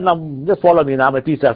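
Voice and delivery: ordinary delivery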